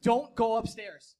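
slow, loud, spaced-out words; pointed quoted warning. Retold or imitated. Co-host reminding him of a friend's advice, pointed warning. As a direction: spell out the warning word by word.

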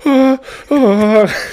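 loud, high-pitched imitation sobs; mock crying. Retelling waking in tears from the nightmare, mock sobbing. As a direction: wail two exaggerated sobs. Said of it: "And then I started crying".

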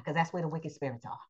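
hushed unvoiced whisper. Preacher whispering to the congregation about wicked spirits. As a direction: drop to a secret whisper about evil spirits.

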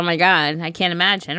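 ordinary talk, nothing marked

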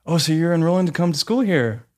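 imitating a cheery over-friendly man; eager smiley guy. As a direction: play the overly eager stranger.

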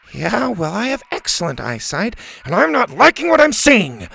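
rising high panicky voice; nervous boy. Acted. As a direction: squeak out your dread. Bo scared of spiders.

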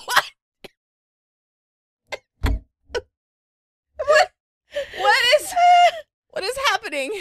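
high, loud, disbelieving what; shrieking disbelief. Real, not acted. Cohost reacting to the host's confession, shrieking in disbelief. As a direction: squeal it like you can't believe your ears.

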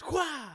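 a sharp, high whip-crack yelp, loud; whip crack noise. Retold or imitated. Telling friends how dad whipped brother, mock whip-crack yell. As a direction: mimic the branch cracking down on the legs. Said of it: "And then fucking came another one".

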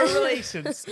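laughing mock repeat of "Relations."; mocking echo. Sister teasing the misread letter word, laughing. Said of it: "mocks the misread word".

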